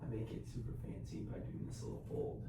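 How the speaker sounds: hushed, soft-spoken morning talk; quiet. Real, not acted. I gotta be a little quiet 'cause Sean is sleeping.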